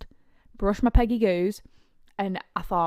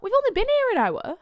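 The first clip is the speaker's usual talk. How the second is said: disbelieving; 5 dB louder than usual. Retold or imitated. Disbelief at the lost hour.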